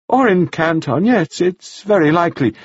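chattering widow, pleased with herself; gushing widow. A narrator's reading. Mrs Wells.

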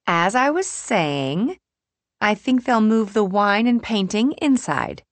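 organizer friend resuming. Acted. May after clearing her throat, pointedly resuming.

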